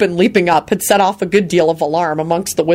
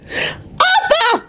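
very high exasperated screech. A narrator's reading. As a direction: screech his name fed up. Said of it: "screeched in an exasperated voice".

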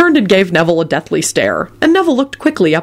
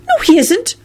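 very high, puzzled voice; bewildered Luna; 13 semitones higher than usual. A narrator's reading. Luna contradicts Neville about Parseltongue, bewildered. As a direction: correct them in puzzlement. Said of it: "in a bewildered voice".

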